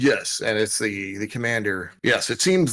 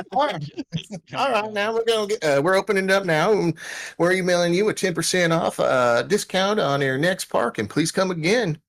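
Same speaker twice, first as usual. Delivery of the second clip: high-pitched, folksy parking attendant; folksy parking controller; 9 semitones higher than usual. Acted. Parking control to departing ship, folksy and high-pitched.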